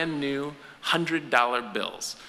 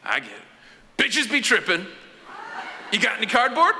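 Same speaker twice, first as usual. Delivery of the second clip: dealer voice rising; 8 semitones higher than usual. Retold or imitated. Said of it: "looks me right in the eye and goes".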